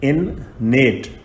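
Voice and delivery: very slow, stretched out for writing; dictating at a crawl; slow dictation. Shown as a demonstration. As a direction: drag each sound out for the class. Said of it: "उसके बाद है innate".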